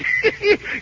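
short high chuckle; pleased, high-pitched cackle; gleeful laugh. Acted. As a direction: laugh with triumph. Gleeful after retelling.

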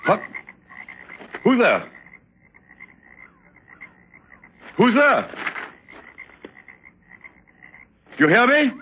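urgent shouts with rising alarm; alarmed calling out. Acted. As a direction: call nervously for whoever is out there. Calls out into the dark, alarmed.

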